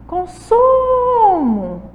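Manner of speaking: Consumo called high, loud and very slow, su drawn out; stressed middle syllable. Shown as a demonstration. Teacher calling the word out to show the strong su. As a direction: hold the su in Consumo. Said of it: "O su aí".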